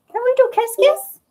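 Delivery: talking sweetly to her cat Luna; cooing pet talk. Real, not acted.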